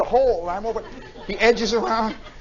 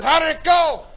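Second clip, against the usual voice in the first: raised, loud questioning call; lieutenant; 7 semitones higher, 6 dB louder than usual. Retold or imitated. Lieutenant asking Johnson about the drop, loud raised call. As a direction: shout the question back over the noise. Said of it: "The lieutenant says".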